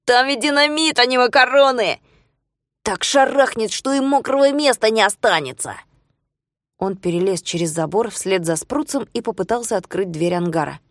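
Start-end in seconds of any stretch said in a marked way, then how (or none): 0.0-5.8 high, louder furious scolding; angry scolding. Acted. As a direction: scold him sharply, raised pitch. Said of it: "зашипел на него Жулио".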